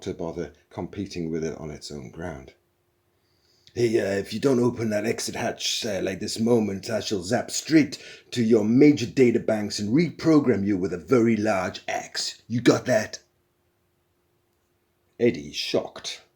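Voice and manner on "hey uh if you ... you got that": louder, menacing threat. A narrator's reading. Zaphod threatening the computer, loud menacing threat. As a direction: lay down the threat firmly.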